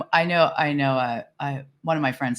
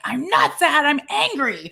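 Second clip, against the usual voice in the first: high, indignant insistence; frustrated protest; 10 semitones higher, slower than usual. Retold or imitated. Telling herself off while crying, high frustrated protest. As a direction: protest through tears of anger. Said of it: "I get mad that I'm crying 'cause I'm like".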